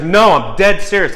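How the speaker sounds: high, loud insistence, mimicking distress; retold distraught protest; imitated sobbing. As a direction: insist through imitated upset. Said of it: "she's sobbing uncontrollably".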